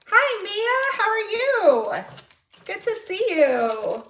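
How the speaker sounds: very high, singsong hello; real excited greeting. Real, not acted. Livestream host greeting a viewer who just arrived, excited. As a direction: greet with a drawn-out, high lilt. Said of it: "higher and louder when I get excited".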